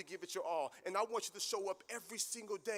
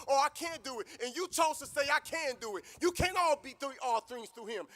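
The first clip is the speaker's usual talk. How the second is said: loud, higher, rising preacher's cry; preaching at full force; 7 semitones higher, 11 dB louder than usual. Acted. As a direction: preach it to the graduates, building to a roar. Builds into preaching climax.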